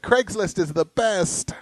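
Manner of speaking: high, drawn-out gushing quote; mock delighted woman. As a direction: imitate a thrilled customer, stretching the words. Voices the delighted spanked woman.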